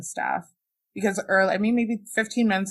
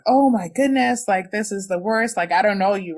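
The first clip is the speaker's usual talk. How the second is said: mock dramatic sympathy; 3 semitones higher, 7 dB louder than usual. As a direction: ham up fake concern from a stranger. Mocking overdone sympathy she didn't want.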